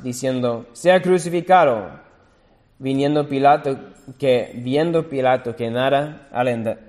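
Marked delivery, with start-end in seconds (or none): 0.7-2.0 louder, higher crowd cry; crowd shouting. A narrator's reading. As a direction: cry it out as the mob. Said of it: "gritaban aún más".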